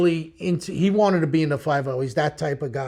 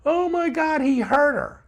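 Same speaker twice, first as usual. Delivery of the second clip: panicked mother; 9 semitones higher than usual. Retold or imitated. Distraught mother on the steps.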